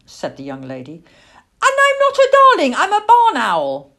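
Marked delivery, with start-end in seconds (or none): 1.6-3.9 loud, high squawk of correction; huffy little owl at full volume; indignant owlet. A narrator's reading. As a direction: set her straight in an offended child's voice. Cross owlet correcting the young lady.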